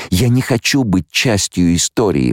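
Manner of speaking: ordinary delivery, nothing marked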